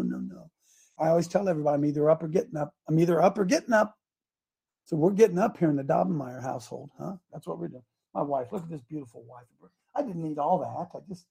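normal talking voice

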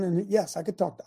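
ordinary talk, nothing marked

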